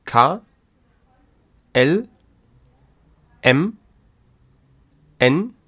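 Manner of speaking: each German letter said alone with pauses to repeat; teacher reading the German alphabet aloud. Shown as a demonstration. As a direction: read the alphabet out for learners to echo.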